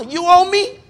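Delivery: high, loud turnaround on the debt; emphatic demand. Retold or imitated. Employer tells the unpaid worker he owes, emphatic demand. Creditor turns the debt around.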